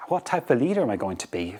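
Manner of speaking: usual speaking voice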